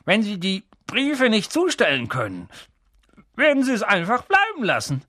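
talking through a mouth full of nails. A narrator's reading. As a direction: mumble around nails. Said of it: "mit dem Mund voller Nägel".

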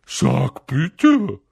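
teasing, drawn-out poltergeist lilt; Peeves, singsong. A narrator's reading. Peeves stalling Filch, annoying singsong. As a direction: needle him for manners. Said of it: "mit einer nervigen Singsangsstimme".

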